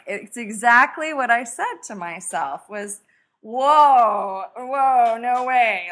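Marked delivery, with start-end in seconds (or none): 3.4-5.9 loud, drawn-out retold protest; alarmed balking. Wife recalling being asked to write a book, balking. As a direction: balk loudly, as if halting someone.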